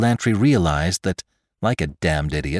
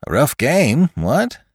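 high-pitched, lively remark; jaunty newcomer; 8 semitones higher, slower than usual. Acted. Man enjoys what he thinks is a game, jaunty. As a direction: toss it off high, ending on a questioning lift.

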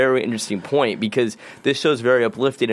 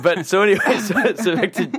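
laughing; 8 semitones higher than usual. Real, not acted. Laughing while getting back on topic.

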